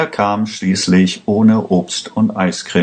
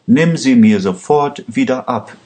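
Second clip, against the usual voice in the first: mildly furious, higher-pitched protest; understated narrated anger, pitch raised; angry cry; 6 semitones higher than usual. A narrator's reading. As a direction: read her outrage high, without really shouting. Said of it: "schrie Lisa Pandora wütend".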